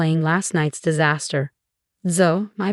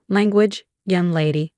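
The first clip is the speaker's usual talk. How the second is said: ordinary delivery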